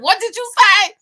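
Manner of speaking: put-on high and loud, thrown by her accent; startled what. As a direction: blurt it out as if you can't believe your ears. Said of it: "and I'm like".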